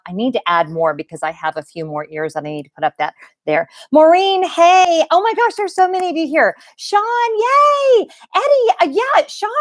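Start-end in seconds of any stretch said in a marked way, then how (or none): 3.9-9.5 excited greetings. Real, not acted. Greeting many viewers joining live.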